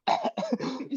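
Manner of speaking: mock choking cough; imitated gas cough. Retelling gas hitting him mid-laugh, mock choking cough. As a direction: cough as if gas caught your throat.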